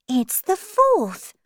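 put-on high, slow girl character voice; Alice. A narrator's reading. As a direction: answer high and deliberately.

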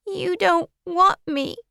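put-on very high child's voice on the edge of tears; tearful girl. Girl tells Marilla nobody wants her, close to tears. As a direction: plead like a rejected child about to cry. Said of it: "She started crying very hard".

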